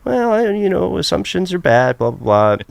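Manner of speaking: high-pitched start, falling as it dismisses; retold wife. Wife cutting off her husband's griping, lightly mocked. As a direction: imitate a wife brushing it off, high then dropping. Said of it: "she would go".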